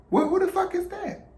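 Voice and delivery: high, loud shocked question; shocked grandma demanding an answer; startled demand. Retold or imitated. Grandma spotting a stranger on her couch, shocked and loud. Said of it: "she says".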